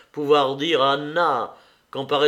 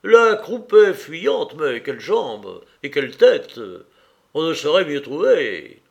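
loud, relishing connoisseur; enthusiastic praise; 3 semitones higher, 7 dB louder than usual. A narrator's reading. Yachvin praising a horse to his friend, loud enthusiasm. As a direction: admire each point of the horse with gusto.

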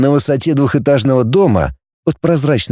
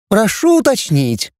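high, lightly mocking robot query; parodying an android; 9 semitones higher than usual. A narrator's reading. Xavier mimics the cyber to tease Benny, mock android. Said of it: "словно пародируя Кибера".

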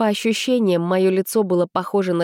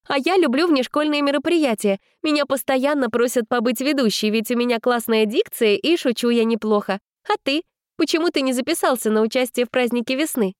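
put-on high eager chatty girl; 6 semitones higher than usual. A narrator's reading. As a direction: push on eagerly.

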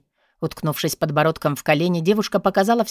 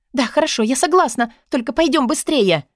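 high, eager agreement; high-pitched anxious assent; impatient Katarina; 7 semitones higher than usual. A narrator's reading. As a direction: agree in a hurry to get away. Katarina agrees, hurrying him.

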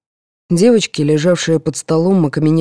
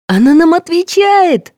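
high, loud delighted cry; thrilled that the spirit answers; overjoyed; 12 semitones higher, 5 dB louder than usual. A narrator's reading. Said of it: "обрадовалась Лаура". (радостно, восторженно)

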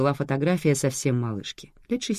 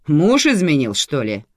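loud, pointed question falling at the end; strict boss demanding confirmation; stern; 3 semitones higher, 9 dB louder than usual. A narrator's reading. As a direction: probe bluntly like an interrogator. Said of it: "уточнила строго начальница".

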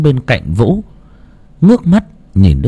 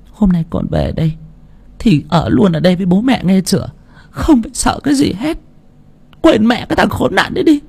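put-on old mother; 9 semitones higher than usual. A narrator's reading. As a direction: reassure her daughter as the grandmother. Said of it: "bà cụ lại nói". (bà cụ bảo con ở lại, giọng cao)

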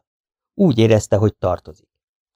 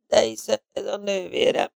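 soft, faint and high; a feeble exclamation, barely voiced; weak whisper; 10 semitones higher, 6 dB quieter than usual. A narrator's reading. Weakened Smith reveals she is his sister, softly. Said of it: "suttogta egészen elgyengülten".

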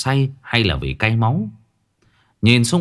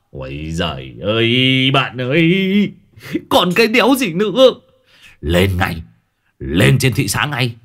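booming; 4 semitones higher than usual. A narrator's reading. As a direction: blare it like a village loudspeaker. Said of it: "giọng oang oang như cái loa phường". (giọng oang oang như loa phường)

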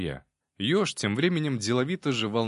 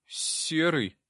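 put-on higher-pitched little boy voice; timid boy; 7 semitones higher than usual. A narrator's reading. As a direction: answer as a shy boy. Timid boy giving his name.

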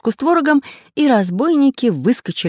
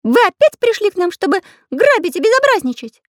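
very high, louder accusing voice; threatening; 4 dB louder than usual. A narrator's reading. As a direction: accuse them in a high character voice. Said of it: "с угрозой спросил Карандаш".

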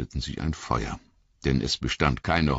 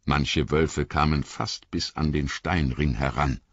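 ordinary delivery

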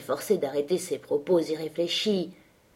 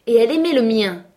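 louder, higher goading retort; loud taunt; 4 semitones higher, 7 dB louder than usual. A narrator's reading. Linton goading exasperated Catherine, loud taunt. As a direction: rub it in loudly.

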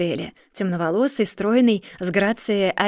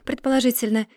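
plain reading voice

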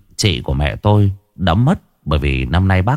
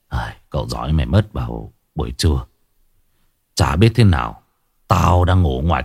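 put-on older uncle; 5 semitones lower than usual. A narrator's reading. Cậu Tuấn đứng lên kể.

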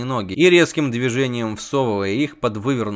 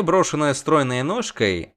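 ordinary delivery

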